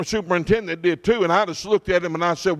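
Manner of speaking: ordinary talk, nothing marked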